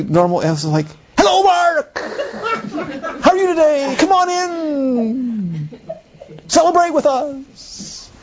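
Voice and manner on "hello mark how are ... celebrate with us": much higher, slow, sweeping stagey welcome; expansive thespian. Retold or imitated. Actor welcoming Mark in, expansive and theatrical. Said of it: "So incredibly expansive".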